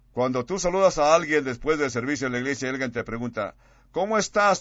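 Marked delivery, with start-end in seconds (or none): 3.9-4.6 higher and louder, a bright sing-song hello; cheery church greeting. Retold or imitated. Churchgoer greeting someone after the service, chirpy and loud.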